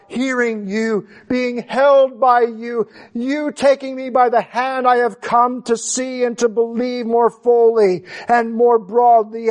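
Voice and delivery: full-voiced sermon delivery; fervent preaching. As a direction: preach it out to the congregation. Impassioned preaching run.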